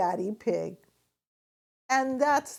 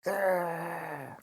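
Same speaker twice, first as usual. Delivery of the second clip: dragon growl; 3 semitones lower, 3 dB quieter than usual. A narrator's reading. Playing the dragon.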